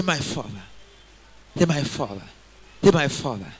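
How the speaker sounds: soft, close and tender; intimate. Shown as a demonstration. Congregation guided to say it softly, intimate. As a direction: say it like a child close to a father. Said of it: "Make it intimate. Make it close".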